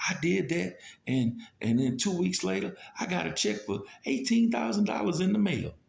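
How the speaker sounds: high-pitched mock testimony from a churchgoer; testifying woman.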